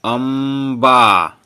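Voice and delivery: अम्बा loud and very slow, long m and long final vowel; drawn-out pronunciation. Shown as a demonstration. Teacher lets pupils hear the m, अम्बा loudly stretched. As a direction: belt out अम्बा syllable by syllable, humming the m. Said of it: "इसका उच्चारण मो होगा".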